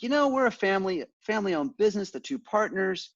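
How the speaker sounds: lightly voiced office controller; quoting the controller. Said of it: "She's like".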